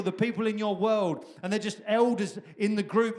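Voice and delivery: ordinary delivery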